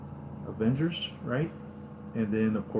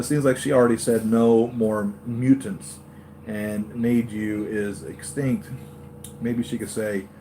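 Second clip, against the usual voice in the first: normal talking voice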